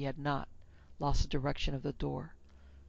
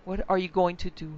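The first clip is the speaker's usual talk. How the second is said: plain reading voice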